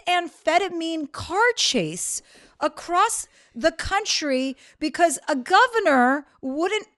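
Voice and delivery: imitated incredulous rebuke, high and very slow; exasperated scolding. Brother telling sister what she did to her dogs, exasperated.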